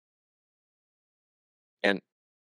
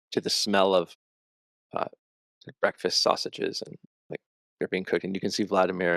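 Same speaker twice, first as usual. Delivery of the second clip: ordinary talk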